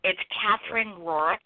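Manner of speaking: usual speaking voice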